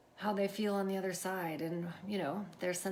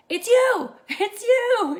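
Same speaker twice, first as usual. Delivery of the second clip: re-enacted high, loud squeal of excitement; excited shout; 17 dB louder, much slower than usual. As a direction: shout it high, thrilled for the caller. Retold thrill at niece being picked.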